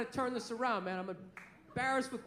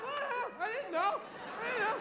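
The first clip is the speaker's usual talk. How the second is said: playing dumb; 10 semitones higher than usual.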